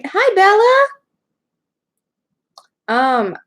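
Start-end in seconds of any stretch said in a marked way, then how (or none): 0.0-0.9 very high, loud hello; high pet-greeting voice. Real, not acted.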